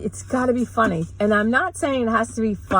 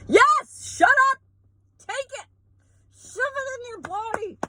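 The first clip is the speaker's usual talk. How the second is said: much higher, hammed-up yelling at the public; mock frantic yell; 13 semitones higher than usual. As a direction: parody an official screaming orders. Mocking authorities forcing vaccine.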